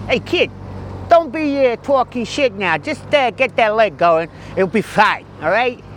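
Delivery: gee himself up like a gruff trainer; tough coach. Acted. Injured cyclist pep-talking himself as a coach, mock-tough.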